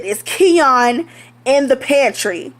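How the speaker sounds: each word spaced out and firm; deliberate demand; slow, pointed. Retold or imitated. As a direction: ask again, slow and pointed. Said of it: "I said".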